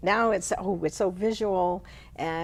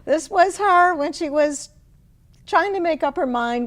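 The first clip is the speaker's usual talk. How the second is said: laughing high through the words; real laugh spilling into speech; 8 semitones higher, 5 dB louder than usual. Real, not acted. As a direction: giggle while starting the line. Laughing about her mother poem.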